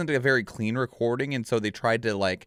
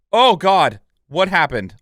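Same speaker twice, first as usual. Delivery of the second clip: startled; 6 semitones higher, 8 dB louder than usual. Real, not acted. Clicked something by accident.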